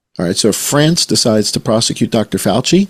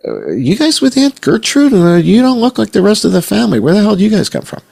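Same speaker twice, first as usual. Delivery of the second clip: high, fast mock bewilderment; baffled family member; 11 semitones higher, 4 dB louder, faster than usual. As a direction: quiz the picnic gatecrashers, voice shooting way up. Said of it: "and you're like".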